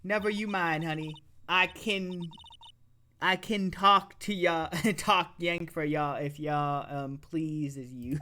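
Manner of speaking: slow, easy Southern drawl; unhurried Southern drawl to a city lawyer. Acted. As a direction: coax him along, easygoing country gal. Lotta offers to talk Yankee.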